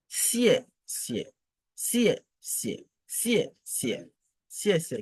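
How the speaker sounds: SIE starts high and falls steeply; fourth-tone demo. Shown as a demonstration. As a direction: drill each SIE with a falling tone. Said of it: "les quatrièmes tons".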